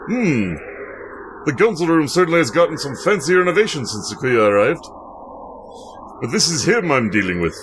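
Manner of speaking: lightly voiced suave secret agent; suave spy. Sakazaki greeting Mister One, a hint of suave agent. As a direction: greet your handler like a smooth spy.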